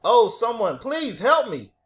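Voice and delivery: loud, high panicked plea; crying for help. A narrator's reading. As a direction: shout desperately to be rescued. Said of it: "they heard a voice cry".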